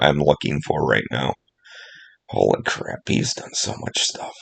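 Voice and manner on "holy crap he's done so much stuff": whispered, much lower and softer; hushed amazement under the breath; awed whisper. Real, not acted. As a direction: breathe it out in awe. Awed at long filmography.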